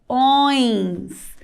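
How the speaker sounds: "Ões" as a long nasal õe closing on s; plural ending. Shown as a demonstration. Teacher isolating the plural ending, drawn-out nasal "Ões". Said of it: "no plural".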